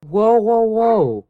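high, loud string of whoas; urgent interrupting. Acted. Dog trainer halts upset owner's rant, urgent calming. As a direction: cut in to halt a tirade.

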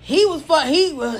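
normal talking voice

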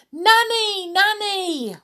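high, loud two-note holler; calling out. Acted. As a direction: call out shrilly for someone out of earshot. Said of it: "So Amelia Jane called again".

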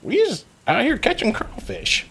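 high, innocent-sounding goblin; nervous goblin. Acted. Goblin lying to the heroes, high nervous voice. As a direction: fib with a squeak.

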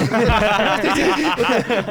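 slow, laughing through the question; mock the endless tourist question while cracking up; laughing imitation of tourist. Telling a friend about endless lost tourists, laughing slowly.

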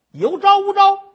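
high, loud demand held long; 厉声逼供; interrogating shout. Acted. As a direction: roar the question down at her. Magistrate demands confession.

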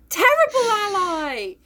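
high, drawn-out "terrible ally"; comic wailing echo; mock outrage. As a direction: stretch it out in exaggerated indignation. Echoes the verdict in mock outrage.

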